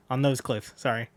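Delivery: normal talking voice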